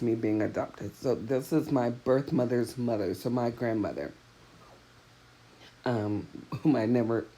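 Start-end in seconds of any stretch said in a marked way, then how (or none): none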